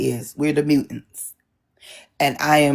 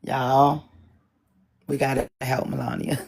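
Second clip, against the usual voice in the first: quiet aside; 3 semitones lower, 7 dB quieter than usual. Real, not acted.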